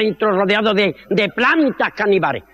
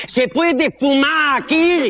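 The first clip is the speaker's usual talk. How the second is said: mock serious; 3 semitones higher, much slower than usual. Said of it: "dice este muy serio el taxista".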